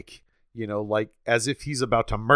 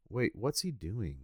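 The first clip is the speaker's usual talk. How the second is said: puzzled; 9 dB quieter than usual. Retold or imitated. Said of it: "kinda be like".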